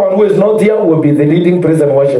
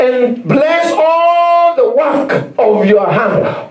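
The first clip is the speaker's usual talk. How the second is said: higher, slow preaching read; fervent scripture reading; 6 semitones higher, slower than usual. Acted. Preacher reads Deuteronomy to the congregation, slow and fervent. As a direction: proclaim each blessing slowly.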